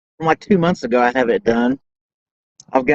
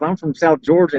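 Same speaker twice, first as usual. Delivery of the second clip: ordinary delivery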